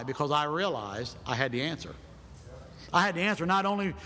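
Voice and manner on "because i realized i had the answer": ordinary talk, nothing marked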